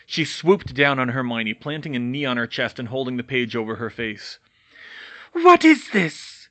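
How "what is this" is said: high, loud, slow crazed witch voice; hissed menace. Acted. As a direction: drag out the threat like a madwoman. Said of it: "she hissed".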